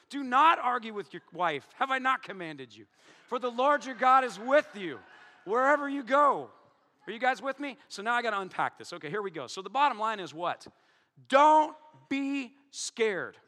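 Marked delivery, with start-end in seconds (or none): 1.8-6.5 from plain to loud and high; preacher building to a loud climax; building scripture reading. Acted. Reads scripture with rising force. 11.3-13.4 very slow, loud and high; booming pulpit command. Acted. Preacher hammers the bottom line.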